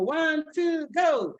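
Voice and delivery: high shouted count-in. Shown as a demonstration. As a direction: start the song with a shout. Counting in the song.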